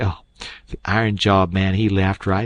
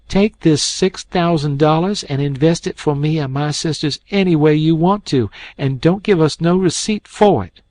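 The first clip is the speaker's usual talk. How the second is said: higher, growing louder; proud defiant girl; 7 semitones higher, 5 dB louder than usual. A narrator's reading. Mary Jane giving the king the money, defiant. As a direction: hand it over in defiance.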